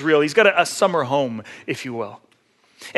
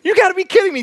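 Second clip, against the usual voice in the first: high-pitched mock disbelief; condescending wife; 11 semitones higher, 4 dB louder, faster than usual. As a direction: scoff loudly, pitch climbing way up. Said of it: "She, like, goes condescending".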